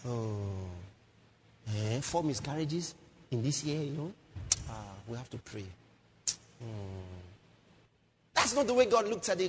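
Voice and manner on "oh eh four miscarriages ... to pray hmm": low, soft, humming pity; mock resignation. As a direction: imitate helpless churchgoers murmuring concern. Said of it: "that's our mentality".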